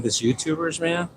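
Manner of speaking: usual speaking voice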